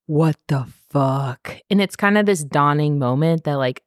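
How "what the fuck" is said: low, drawn-out stunned voice; slow-motion shock, each word stretched; stunned disbelief. Retold or imitated. Recapper voicing captain spotting his squad in slow-mo, stunned.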